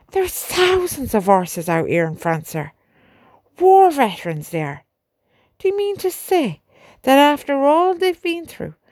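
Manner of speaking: rising outrage on the horses' behalf; indignant sergeant pleading. A narrator's reading.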